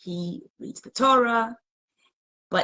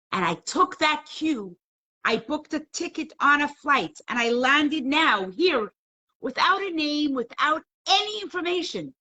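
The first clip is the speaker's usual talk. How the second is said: high, emphatic acted telling; fervent testimony; 8 semitones higher, 3 dB louder than usual. Visiting rabbi tells the wife how he came, acted fervour. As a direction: stretch it out in rising amazement. Rabbi recounts his sudden journey.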